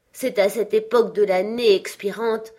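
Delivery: a chained spirit grieving his wasted life; Marley's ghost. Acted. Ghost's lament. (le fantôme de Marley, plainte solennelle et forte)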